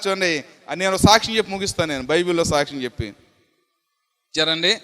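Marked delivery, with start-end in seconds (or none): none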